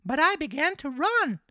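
very high, loud puppet voice; marionette voice. A narrator's reading. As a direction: pitch it way up as the naive little marionette. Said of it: "continued the marionette".

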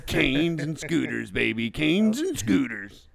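slow, loud title call; mock game promo. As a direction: draw out the title like a hype announcer.

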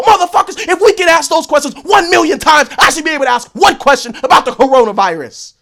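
loud, high furious outburst; real angry rant. Real, not acted. Vlogger ranting loudly at fans about coronavirus questions. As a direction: blow up at the fans. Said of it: "but it was angry".

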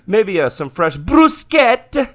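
high ordering voice bursting into Italian food names; over-the-top Italian diner, giggling; mock Italian accent. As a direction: blare the dishes in hammed-up Italian. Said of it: "Every time I do an Italian voice".

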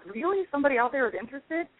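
high, quick, astonished question; surprised disbelief. Retold or imitated. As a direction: gasp it out in disbelief. Retold shocked reaction to readers.

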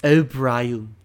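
cartoon-character voice on the name; Stewie Griffin. Friend gives a tease of his cartoon voice, mimicking. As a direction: put on a cartoon voice, drawing out the name. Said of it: "trying to perfect my Stewie Griffin voice".

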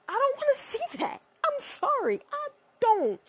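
high, mock-sorry refusal; sheepish high apology. Real, not acted.

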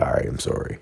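a meek whispered apology; sheepish whisper. Retold or imitated. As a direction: mumble the sorry, ashamed. Sheepish apology after being caught.

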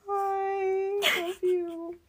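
weepy, half-laughing farewell; tearful goodbye. Real, not acted. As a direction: say bye through real tears. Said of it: "[name] is currently inconsolable".

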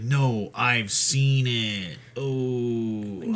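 slow, dazed stoner voice. Retold or imitated. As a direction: drag it out like a stoner. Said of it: "You sound like a stoner".

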